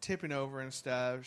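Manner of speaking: ordinary talk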